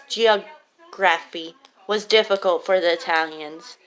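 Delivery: ordinary talk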